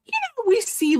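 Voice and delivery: lightly voiced young girl; high girly voice.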